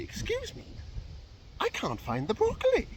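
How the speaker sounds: high-pitched, mock-irate shopper; hammed-up angry woman's voice; really angry customer. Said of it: "she's getting really angry".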